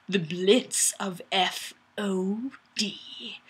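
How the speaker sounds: slow, hushed, menacing whisper; whispered dramatic reading of the comment. Pretend. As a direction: breathe it like a movie trailer. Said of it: "it's really dramatic now".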